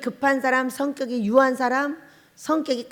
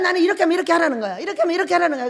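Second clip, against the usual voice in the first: higher, fast mock nagging; imitating the nagging deaconess; 5 semitones higher, 4 dB louder, much faster than usual. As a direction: rattle off her fussy orders.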